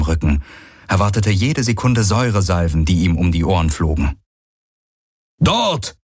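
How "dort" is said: high, sudden call. A narrator's reading. Hawks spots something ahead for the riders, calling out. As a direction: call it out as a sighting, pitched up. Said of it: "rief Hawks".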